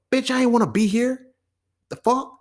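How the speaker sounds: high, loud indignant yell; angry addict. Retold or imitated. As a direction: yell at the family. Addict voiced at intervention.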